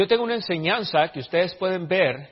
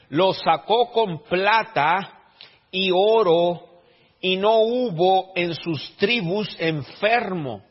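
slow, emphatic preacher's reading, raised pitch; drawn-out, weighty pulpit delivery; scripture reading; 4 semitones higher, 4 dB louder, much slower than usual. Acted. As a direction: proclaim the verse word by word. Reads the Bible verse aloud.

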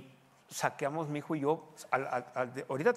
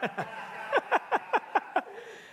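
high breathy laugh; real amused laugh. Real, not acted. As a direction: laugh at his own joke. Laugh after joke.

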